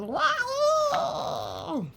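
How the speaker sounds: very high wow; high thrilled wow; amazed duck. Acted. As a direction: marvel at the ride. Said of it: "what he thought was".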